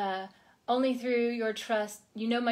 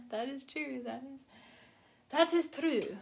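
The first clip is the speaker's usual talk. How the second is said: playful put-on Russian accent, higher; mock Russian accent; 4 semitones higher, 4 dB quieter than usual. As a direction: toss it off in a joking Russian accent. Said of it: "I sometimes just decide to speak in a Russian accent".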